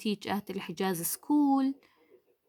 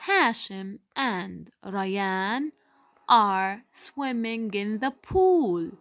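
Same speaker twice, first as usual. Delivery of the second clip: slow, careful sentence for the class; slow model reading; 2 semitones lower, slower than usual. Shown as a demonstration. Teacher reading the example sentence to pupils, slow and careful. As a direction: spell each word out slowly for young learners. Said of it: "teacher reads model sentence slowly".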